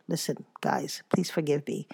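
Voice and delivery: normal talking voice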